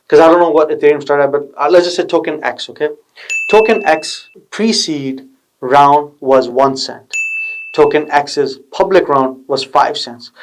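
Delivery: normal talking voice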